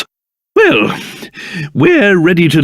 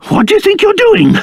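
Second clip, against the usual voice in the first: high, quick, outraged demand; indignant challenge; 12 semitones higher, faster than usual. Acted. King catching an intruder at the scepter, indignant.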